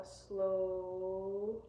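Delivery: SLOW held long to mimic sluggish waves; drawn-out slow. Shown as a demonstration. Teacher showing how slow the brain waves are getting, drawn-out. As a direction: stretch SLOW out into one long vowel.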